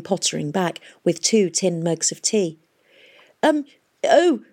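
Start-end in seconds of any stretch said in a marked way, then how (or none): 3.4-4.4 high, hesitant flustered noises; flustered, halting fillers; embarrassed mumble. A narrator's reading. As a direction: stumble awkwardly between little sounds. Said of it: "he mumbled".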